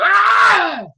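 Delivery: a high, loud mock spider scream. Showing spiders don't scream while swinging, a high mock scream. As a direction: yelp like a scared spider on its web. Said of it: "You don't hear them scream".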